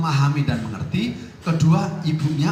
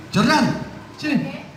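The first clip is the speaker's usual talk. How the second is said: Jordan called out with a sharp, much higher pitch; 9 semitones higher, much slower than usual. Retold or imitated. A father calls his son Jordan over, high and sharp. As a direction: summon the boy with a high, sharp call. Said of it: "saya manggil dengan nada tinggi".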